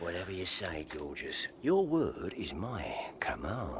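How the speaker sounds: low, soft come-on murmur; a low, close murmur to the barmaid; low seductive murmur. Acted. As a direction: purr it at her, nearly whispering. Wooing the lady, low and secretive.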